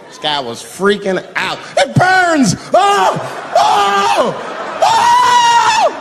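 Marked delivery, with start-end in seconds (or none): 1.8-5.9 very high, loud burning wails; anguished screams. Acted. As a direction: scream it like it burns. Victim freaking out.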